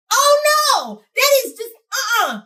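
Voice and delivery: high, loud "oh no" of disapproval; emphatyczna dezaprobata. Real, not acted. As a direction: shoot it down loudly, pitch way up. Reacts in alarm to the red flag.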